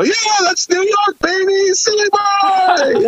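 high, slow, shouted hype-man voice; Silly Boy stage persona. Retold or imitated. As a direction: bellow the silly character's entrance. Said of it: "and I go".

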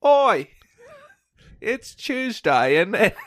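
loud high Cockney "Oi!", then slow mock British; mock Cockney yell asking if it's Tuesday; British accent. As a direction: shout the greeting in a hammed-up British accent.

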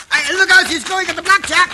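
high, loud warning shout; alarmed shout. Acted. Pancho warning Cisco of the blackjack, alarmed shout. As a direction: yell to warn him in time.

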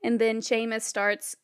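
ordinary talk, nothing marked

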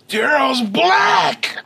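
high crying shout of shock; shocked shriek. Retold or imitated. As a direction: shriek it in disbelief. Crowd shocked discovery.

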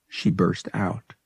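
usual speaking voice

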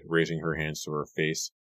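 plain reading voice

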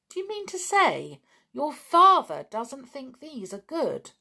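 can't believe what he hears; surprised. A narrator's reading. Julian to Martin about his father, surprised. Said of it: "he said in surprise".

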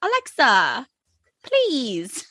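high British plea, drawn out; British accent begging Alexa to stop. Retold or imitated. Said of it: "You do it so good, dude".

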